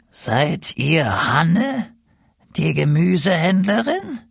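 put-on high, slow, croaky old woman; unpleasant croaking crone. A narrator's reading. Old woman questions the vegetable seller, high unpleasant croak. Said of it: "mit unangenehmer, krächzender Stimme".

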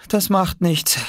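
put-on high child's voice, comforting; high childlike reassurance; boy consoling. A narrator's reading. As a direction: console him in a high boy's voice. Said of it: "tröstete ihn Oskar".

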